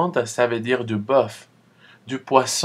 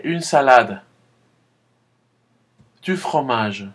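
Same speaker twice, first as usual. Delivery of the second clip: model repetition. Shown as a demonstration. Teacher modelling a word for students. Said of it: "Répétez".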